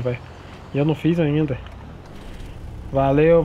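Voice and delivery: ordinary delivery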